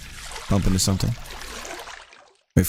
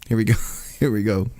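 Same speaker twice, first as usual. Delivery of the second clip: normal talking voice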